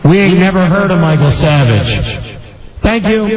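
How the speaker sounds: reverb-drenched podium announcement; mock press statement. Host to gentlemen of the press, mock-official in reverb. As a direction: address the press through big echoing reverb. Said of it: "can I have some reverb".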